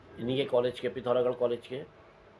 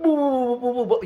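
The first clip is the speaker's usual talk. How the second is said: put-on higher, louder stuttering of a terrified girl; terrified stammer; 8 semitones higher, 4 dB louder, slower than usual. The girls shivering with fright, imitated as a terrified stammer. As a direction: stammer out the sound like she is shaking with fear. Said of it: "यही आवाज़ इनके मुँह से निकल रही".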